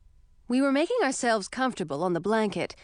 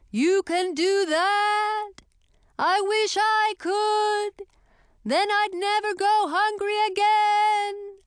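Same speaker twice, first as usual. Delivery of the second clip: squeaky witch voice; 13 semitones higher, 4 dB louder, slower than usual. Acted. Chlorine marvels at food.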